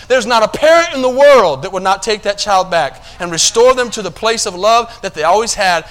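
full-force sermon delivery; preaching. Acted. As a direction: drive the point home to the congregation.

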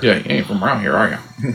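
softly imitated country accent; put-on local accent. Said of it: "somebody who's talking around like this".

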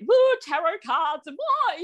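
high, slow spooky wail; mock spooky. Guest mocking her old fear of tarot, mock spooky. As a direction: send up tarot as scary hocus-pocus.

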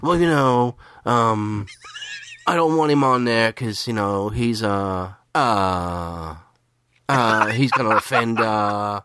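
hesitant mock refusal, stalling on ums and uhs; Lewis. Cohost playing Lewis rejecting him as a guest, halting light impression. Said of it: "I'm trying to do my best Lewis impression".

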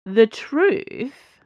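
mock a friend with a sing-song jab. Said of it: "said Porthos in a mocking voice".

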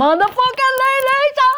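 high excited shout. Retold or imitated. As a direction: yell it out in joy. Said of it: "I start shouting".

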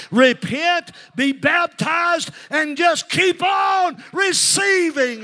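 high, stretched revival holler; full-force preaching shout. Real, not acted. Shouted climax after asking if they hear.

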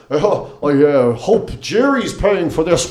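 slow, drawn-out mock comedian delivery; lightly hammed-up hack comic; shit-joke comedian. Comic mocking the act before him with his weak gag, slow mock delivery. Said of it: "doing these shit jokes like".

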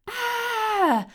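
loud, sustained shriek; long high-pitched cry of alarm; high scream. A narrator's reading. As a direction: scream in fright. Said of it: "screamed Serena and her friends".

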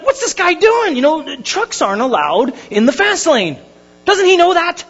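high-pitched mock outrage at another driver; indignant driver. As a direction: reenact an affronted driver's complaint. Indignant at truck in fast lane.

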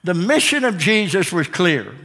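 ordinary delivery

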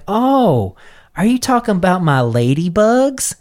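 high voice, sweetly surprised; high, coy senator voice. Retold or imitated. Senator quoted in story.

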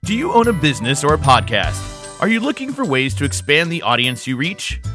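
upbeat, bright radio ad read; commercial read. Acted. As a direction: sell it like a radio spot. Reads ad for Star Productions.

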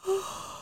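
faked yawn, rising high; hammed-up fake yawn. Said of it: "That was a fake yawn".

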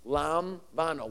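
softly modelled "Lamvano" with a V; Greek pronunciation. Shown as a demonstration. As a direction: sound out "Lamvano" with a pause after the first syllable. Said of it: "But it's pronounced".

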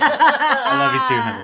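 sweet-talking like a swooning spouse; mock sweetheart. Teasing anniversary mock reply.

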